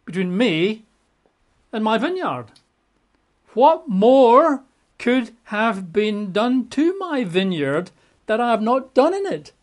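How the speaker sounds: God asking his people to judge; lightly acted God, pitch up; voicing God. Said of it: "says God".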